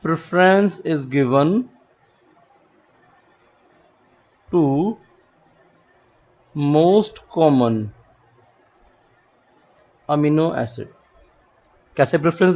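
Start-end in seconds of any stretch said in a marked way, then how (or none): 0.1-10.8 very slow, word by word; dictating at half speed; slow dictation. Shown as a demonstration. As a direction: spell it out slowly for notes. Dictating a rule slowly.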